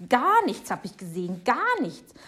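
loud, emphatic rebuttal; indignant denial. Acted. Neighbour denying she saw the Nix, loudly indignant.

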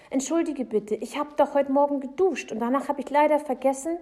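fast, high, loud excuse; boy hastily explaining himself; hurried apology. Acted. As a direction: rattle off the apology urgently.

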